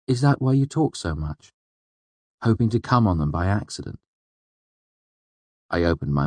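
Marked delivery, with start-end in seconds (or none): 0.1-4.0 teasing woman. A narrator's reading. Narrator voices Denna's teasing question.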